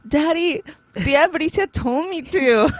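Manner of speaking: put-on high, slow little-kid voice; drawn-out, high-pitched mock kid voice; child tattling. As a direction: imitate a small child telling on someone. Voices her small daughter tattling.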